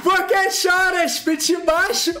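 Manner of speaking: high-pitched crowing between laughs; elated, laughing mockery; gloating taunts. Real, not acted. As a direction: jeer triumphantly, stretched out and breaking into laughter. Taunting viewers while laughing after a win.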